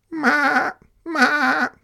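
very high goat bleat; goat's bleat. Acted. Goat calling to the spider, high bleat. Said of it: "said the goat".

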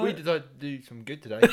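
mock a muddled New Zealand-ish accent; mixed New Zealand accent. Co-host copying the do-gooder line, mongrel New Zealand accent. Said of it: "a mix between New Zealand and".

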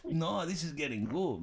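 usual speaking voice

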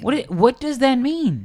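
exasperated, high incredulous question; baffled outrage. Real, not acted. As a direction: demand an answer, voice jumping right up. Outraged at the movie news.

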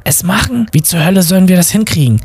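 high-pitched, flustered objection; incredulous protest. A narrator's reading. Bob objects to Peacock's plan, incredulous. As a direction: question it high, in disbelief.

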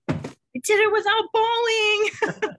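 high, slow and near tears; holding back real tears on the words; voice breaking. Real, not acted. Reader overcome reading final line.

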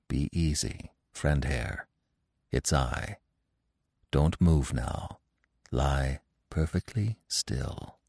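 low, soft, soothing murmur in the ear; calm a frightened friend, softly and low; gentle, sympathetic. A narrator's reading. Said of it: "with a gentle voice that went to Bambi's heart".

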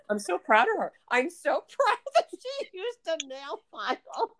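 laughing while gushing with pride; proud through laughter. Retold or imitated. Telling a friend about the desk, laughing proudly. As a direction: giggle through the proud words.

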